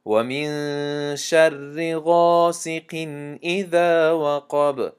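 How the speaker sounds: slow melodic tajweed chant, drawn out; Quran recitation. Shown as a demonstration. Teacher recites al-Falaq to students in slow tajweed chant. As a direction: recite each verse slowly, measured and melodic.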